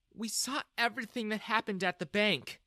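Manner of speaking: lightly voiced woman, high and regretful; sad. A narrator's reading. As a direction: break it gently, with a touch of sorrow. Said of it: "Jessica said sadly".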